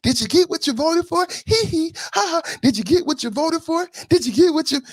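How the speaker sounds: high teasing taunt with giggles; taunting sing-song. Pretend. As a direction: needle them, high and giggly.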